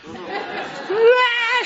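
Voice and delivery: high-pitched hammed-up scream; mock panicked scream. Daughter after a spider ran across her hand, mock scream. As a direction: imitate someone totally losing it in panic. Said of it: "she just totally lost it".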